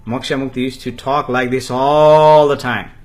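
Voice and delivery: "all" stretched very long and loud; stress on "all". Real, not acted. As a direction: draw out "all" at full volume. Emphasizes how constantly he talked.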